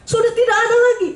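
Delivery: shrill indignant preacher's cry; outraged exclamation. Retold or imitated. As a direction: cry out in disbelief at the cruelty. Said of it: "Saya bilang".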